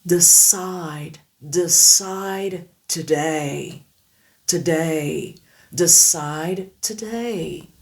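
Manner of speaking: Decide today, very slow; Decide today très lentement; slow drill sentence. Shown as a demonstration. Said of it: "Essayez maintenant dans une phrase".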